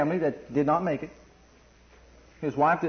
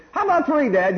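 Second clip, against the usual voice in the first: mock little kid bargaining, much higher; wheedling child; 8 semitones higher, 4 dB louder than usual. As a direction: coax Dad like a small child. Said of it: "you're just giving".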